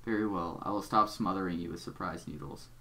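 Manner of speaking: low, measured, quiet villain voice; deep Doc Scratch voice. Acted. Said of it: "a really deep voice".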